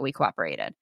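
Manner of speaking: low, quiet mock-robotic voice; deadpan alien envoy; deep put-on voice. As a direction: drop way down for the punchline. Bit punchline in put-on voice.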